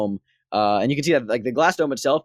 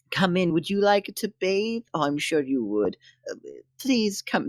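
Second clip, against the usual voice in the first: higher, soft fussing; condescending coddling; 4 semitones higher, 4 dB quieter than usual. Acted. Devotee inviting a visitor to bathe, coddling. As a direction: coax them along like a small child. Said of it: "almost like a child".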